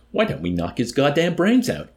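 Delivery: loud, high-pitched eager suggestion; robust enthusiasm. A narrator's reading. Colonel to the others, robust enthusiasm. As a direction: burst out with a rousing idea. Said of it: "he suggested with robust enthusiasm".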